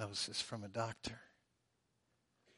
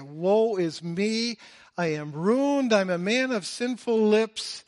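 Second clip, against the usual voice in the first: anguished cry; 8 semitones higher, 11 dB louder than usual. Acted. Isaiah crying out in despair.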